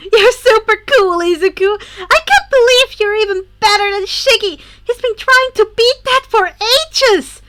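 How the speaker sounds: put-on high, loud, gushing girl; bubbly girl voice. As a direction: gush like an excited fangirl. Himiko voiced in story.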